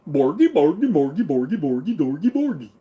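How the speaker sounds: sing-song mock Swedish chef babble; Swedish chef gibberish. Streamer slips into Swedish chef babble, bouncing. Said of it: "sounded like the Swedish chef".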